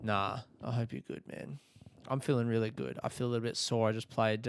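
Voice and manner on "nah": normal talking voice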